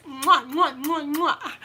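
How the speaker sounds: playful blown kisses; between kisses. Real, not acted. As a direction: blow smacking kisses. Blowing kisses to viewers.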